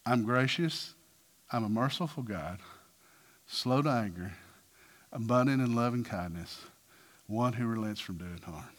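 low, soft, unhurried declaration; hushed, solemn tenderness; God speaking. Acted. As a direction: speak gently and low, pausing after each attribute. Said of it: "this is what God says".

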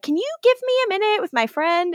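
fast, high voice; quick and high. Retold or imitated. Retelling Caroline's quick request.

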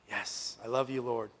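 sighed, low and soft reply; weary sigh. Acted. Peter answering Jesus asking a second time, wearily soft. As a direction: breathe out first, then answer quietly.

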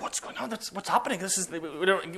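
hushed, confused muttering; soft retold confusion; bewildered mates. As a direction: imitate baffled onlookers, very softly. Mates' bewildered reaction acted out.

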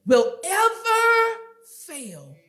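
slow, loud preaching with soaring key words; forceful preaching. Acted. Preacher driving a promise home to the congregation, forceful preaching. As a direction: hammer the verse phrase by phrase.

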